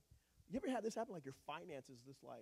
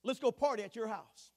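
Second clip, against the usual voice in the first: loud, higher call down from the tree; 10 semitones higher, 11 dB louder than usual. Retold or imitated. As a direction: shout up to Zach, inviting him. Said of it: "He said".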